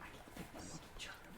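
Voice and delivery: unvoiced whisper aside. Real, not acted. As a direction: whisper it under breath.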